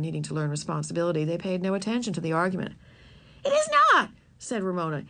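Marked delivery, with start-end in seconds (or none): 3.4-4.1 much higher, louder protest; little girl protesting, much higher and louder; furious girl. Acted. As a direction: shout him down. Girl quarrels with boy.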